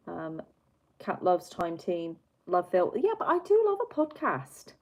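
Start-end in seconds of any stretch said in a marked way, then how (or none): 3.0-4.7 high, loud burst of agreement; keen enthusiasm. Real, not acted. Streamer agreeing keenly with a chat comment, enthusiastic. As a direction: raise pitch and volume, punch DO.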